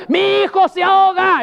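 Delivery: high, loud, panicked mother's cry; desperate cry. Retold or imitated. Preacher voicing a mother crying for her drowning son, panicked. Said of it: "Es diferente".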